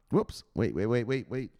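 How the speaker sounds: ordinary talk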